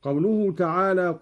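ordinary delivery

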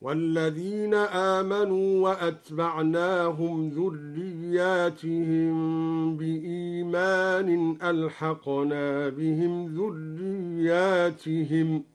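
وأتبعناهم with hamza and silent ta, slowly recited; ذرياتهم recited with alif as plural, drawn out slowly; slow measured chanting of ذرياتهم; Duri reading, slow recitation. Shown as a demonstration. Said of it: "هكذا".

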